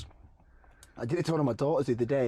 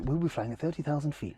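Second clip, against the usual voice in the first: flight attendant announcement; 3 semitones higher than usual. Retold or imitated. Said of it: "air flight attendant kind of".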